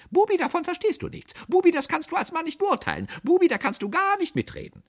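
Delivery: very high, loud, mocking scold; belittling aunt. A narrator's reading. Narrator recalling childhood humiliations, high and belittling.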